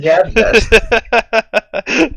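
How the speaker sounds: slow, drawn-out mock question; mock it slowly; mocking disbelief. Real, not acted.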